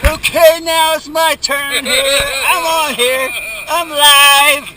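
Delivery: talking loud. Real, not acted. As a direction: project over the roar of the water. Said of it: "I'm talking loud 'cause it's in the waterproof case".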